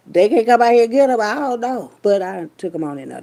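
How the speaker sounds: ordinary talk, nothing marked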